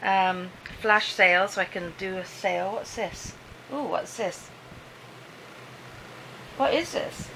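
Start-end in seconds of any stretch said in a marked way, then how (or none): 2.7-4.5 What's this? muttered much softer, falling at the end; mumbled to herself, very soft; quiet curious murmur. Real, not acted. Leafing through the new planner. 6.6-7.3 What is this? pitched high and rising; a higher, delighted question; intrigued, rising question; curious surprise. Real, not acted. Leafing through the new planner.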